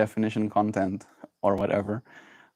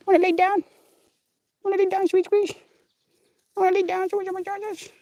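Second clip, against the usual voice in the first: much higher cutesy cooing. Real, not acted. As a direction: coo at the cat in a tiny high voice. Coaxing cat.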